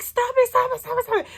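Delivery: high, desperate cry to stop the train; frantic calling out. Retold or imitated. Banging on the train door to the staff, frantic high cry. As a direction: yell at the doors before it leaves.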